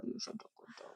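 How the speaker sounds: soft whispered praise for the dog. Real, not acted. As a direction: whisper You're such a good dog to the pet. Praising dog, whispered then voiced.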